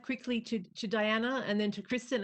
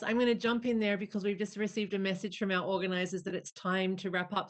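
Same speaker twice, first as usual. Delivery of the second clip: ordinary talk, nothing marked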